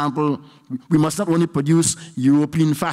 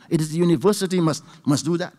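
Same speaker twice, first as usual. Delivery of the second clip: usual speaking voice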